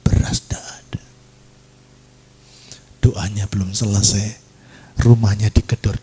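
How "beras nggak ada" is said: whispered, broken plea; retold tearful prayer. Mother alone in her room prays, imitated tearful whisper. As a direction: whisper it like someone crying in prayer. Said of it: "dia nangis".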